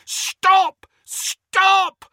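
high desperate shout. Acted. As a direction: shout it with everything you have. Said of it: "Harry shouted".

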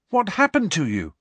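high, insistent question; demanding. A narrator's reading. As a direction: demand an answer in alarm. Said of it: "Harry demanded".

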